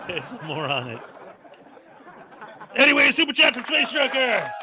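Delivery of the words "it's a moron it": giggling through the words; laughing as he says it. Real, not acted. Laughing at his own joke.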